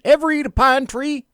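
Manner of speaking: high, loud imitation of the TV naturalist; lightly mocking skit voice; Euell Gibbons skit. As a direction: ham up the old pine tree ad voice. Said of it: "that was a Saturday Night skit".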